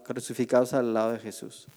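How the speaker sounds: ordinary talk, nothing marked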